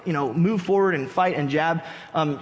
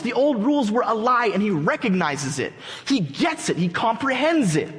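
higher-pitched, driving sermon build; impassioned preaching; 5 semitones higher than usual. Acted. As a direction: build excitement phrase upon phrase.

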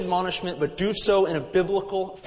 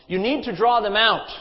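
raised, higher, insistent voice; louder reproach; 5 semitones higher, 6 dB louder than usual. A narrator's reading. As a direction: push the scolding up in volume. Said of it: "The decibels rose some more".

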